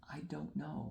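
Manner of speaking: a hushed, breathy reply, barely voiced; whispered I don't know. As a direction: whisper it as if baffled. Retold reply to artist, whispered.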